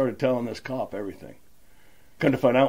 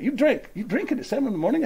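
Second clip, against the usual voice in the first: much higher, laughing mock retort; retorting to wife; 14 semitones higher than usual. Telling his wife about drinking after night shifts, high laughing retort. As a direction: toss it off like a cheeky excuse. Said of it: "I just tell my wife".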